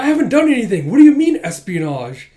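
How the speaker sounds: high, loud protest of innocence; acted-out indignant alarm. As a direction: deny it in a panic.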